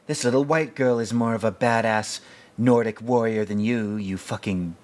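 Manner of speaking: lightly voiced tough woman, laughing through it; mock Ripley chewing him out, half giggling; a hint of Ripley scolding Hudson. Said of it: "She's like".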